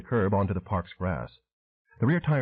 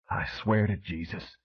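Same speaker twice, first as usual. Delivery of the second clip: seething; slower than usual. A narrator's reading. Flint threatening Pelvis to shut up: slow seething. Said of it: "Flint seethed".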